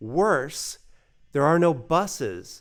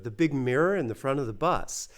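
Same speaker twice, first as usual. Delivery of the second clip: normal talking voice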